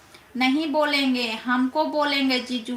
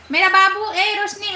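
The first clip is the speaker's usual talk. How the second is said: excited call; 6 semitones higher, 6 dB louder than usual. Real, not acted. Excitedly calling out to Roshni.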